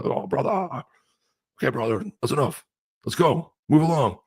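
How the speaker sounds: high, hammed-up wrestler voice; Hulk Hogan impression. Host showing how he goofed on Hulk Hogan, mock wrestler. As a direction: do the Hulk's brother bit. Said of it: "I was just doing the".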